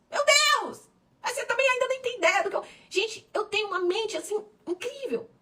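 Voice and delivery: high, excited burst, a bit fast; excited exclamation. Real, not acted. Pastor marvelling to her church about her own dreams, excited. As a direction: squeal it out in amazement.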